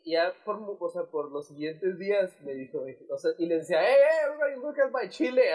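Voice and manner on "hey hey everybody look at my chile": high, loud retold announcement; proudly hailing a crowd; calling out to everyone. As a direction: shout it to the room, raised pitch. Retelling himself calling out to everyone.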